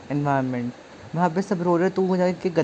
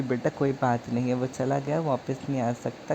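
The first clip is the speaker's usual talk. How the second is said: softer, lower consoling auntie; 4 semitones lower, 6 dB quieter than usual. Retold or imitated. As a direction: soothe him gently like an old auntie at a funeral. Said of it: "aunty ने सहारा दिया".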